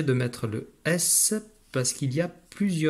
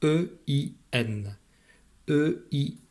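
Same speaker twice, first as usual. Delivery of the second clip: letter by letter, slowly and clearly; slow spelling; slower than usual. Shown as a demonstration. Teacher spelling E I N slowly to a young pupil. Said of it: "dans le mot peindre".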